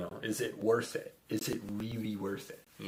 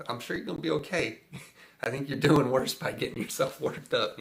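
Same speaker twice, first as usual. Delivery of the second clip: teasing reassurance; 4 semitones higher, 4 dB louder than usual. Retold or imitated. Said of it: "I just, like".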